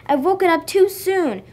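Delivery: high and loud exclamation; gasped dismay. A narrator's reading. Little Bear realising he woke too soon, gasping. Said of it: "gasped Little Bear".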